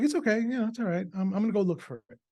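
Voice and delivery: put-on high, childlike reassuring voice; imitating his young son. Dad voicing his son, childlike and high. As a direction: play the little boy brushing it off brightly.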